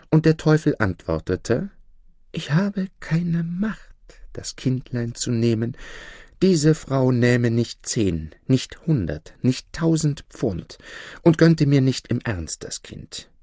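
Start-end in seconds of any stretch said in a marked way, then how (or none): 2.3-13.3 devil. Acted. Devil declines the child.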